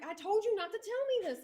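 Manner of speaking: very high, squealed protest; mock shriek, pitched far up without full volume; retold screaming. Candidate scolding her friend outside, imitated screaming. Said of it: "screaming at each other".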